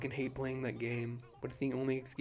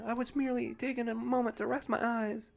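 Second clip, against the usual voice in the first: higher, proper, a little indignant; a sleepy owl insisting she wasn't napping; prim owl character; 7 semitones higher than usual. Acted. Reads Celeste dialogue in voice.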